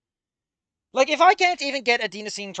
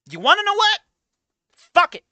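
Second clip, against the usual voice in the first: much higher, louder final challenge; peak shout; 10 dB louder than usual. Real, not acted. Streamer giving up on the writer, loudest exasperated shout. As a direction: let it break at the top.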